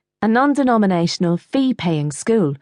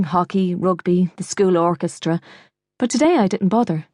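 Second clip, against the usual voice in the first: ordinary delivery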